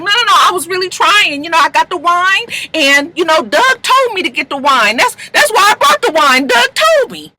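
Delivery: high-pitched, flustered protest; frantic self-defense. Retold or imitated. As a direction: imitate someone pleading their case, rising in agitation. Retold Jackie frantically defending herself.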